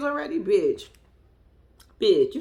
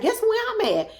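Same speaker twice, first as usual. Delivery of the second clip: imitating her teasing; 10 semitones higher than usual. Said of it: "She was like".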